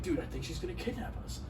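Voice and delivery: very soft, confiding imitation; retold worried murmur. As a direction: murmur it secretly, as the worried friend. Retold friend confiding his worry.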